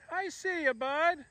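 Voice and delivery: high, soft pet voice; pet talk. Real, not acted. Owner greeting her cat in high, soft pet talk. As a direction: coo it softly, way up high.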